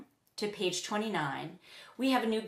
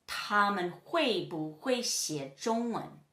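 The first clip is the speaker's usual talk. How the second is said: slow, measured Mandarin example; slow model question; slower than usual. Shown as a demonstration. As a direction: separate the syllables for learners. Teacher models a Chinese example question.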